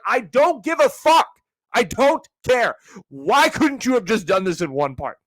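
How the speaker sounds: high, loud mock-furious complaint; exasperated outburst. Viewer fed up with endless part videos, exasperated. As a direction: blow up in high-pitched exasperation.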